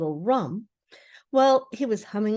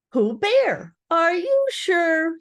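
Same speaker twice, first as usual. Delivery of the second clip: high, slow, louder disbelief; still more surprised; 8 semitones higher, 4 dB louder, much slower than usual. Acted. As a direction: Rabbit doubting it's really Pooh. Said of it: "still more surprised".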